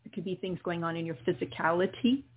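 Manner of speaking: gently guide, calm and steady; guided meditation. Acted. Continues the healing meditation.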